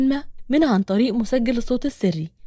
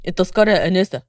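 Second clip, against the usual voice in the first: lower-pitched man's voice; ticket collector; 5 semitones lower, 3 dB louder than usual. A narrator's reading. Ticket collector asks a young woman, low official voice. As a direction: drop the pitch for a ticket man.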